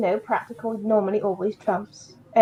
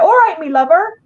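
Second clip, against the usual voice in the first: higher, louder put-on Bristol accent; strong Bristol accent; 8 semitones higher, 4 dB louder than usual. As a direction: imitate a broad Bristol local, pitched up. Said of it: "if you went up to Bristol".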